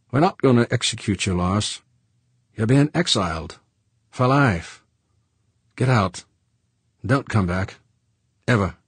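George laying it out bluntly; gruff George. A narrator's reading. George's voice held.

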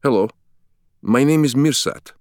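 put-on high, bright-eyed Turkish-accented greeting; enthusiastic Turkish accent. Museum guide greeting the visitors, beaming Turkish accent. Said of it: "his accented English voice brimming with enthusiasm".

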